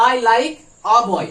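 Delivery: slow, loud dictation; slow teaching demo. Shown as a demonstration. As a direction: spell the sentence out word by word for the class. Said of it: "তাহলে বলবো".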